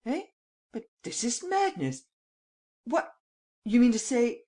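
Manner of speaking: high-pitched, flabbergasted protest; startled. A narrator's reading. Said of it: "Valenglay started".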